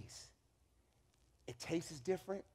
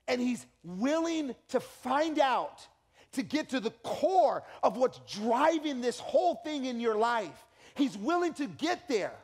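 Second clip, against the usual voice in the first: rising sermon; 3 semitones higher, 3 dB louder, slower than usual. Real, not acted. Building slow preaching.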